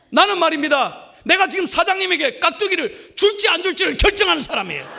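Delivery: high, loud, triumphant retort; cheeky comeback. Retold or imitated. Pastor voicing the young waiter talking back to the boss, cheeky. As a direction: deliver the punchline with swagger.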